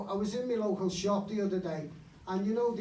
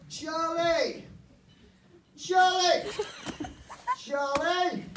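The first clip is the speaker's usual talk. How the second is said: calling out; 10 semitones higher, 7 dB louder, much slower than usual. Retold or imitated. Calling neighbour in the street.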